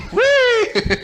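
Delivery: put-on high gleeful whee, like a little boy; gleeful kid squeal. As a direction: squeal with childish joy as the toy flies. Kid playing with parachute toy.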